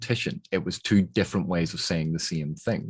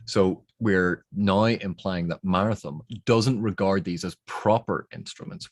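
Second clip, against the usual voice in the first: usual speaking voice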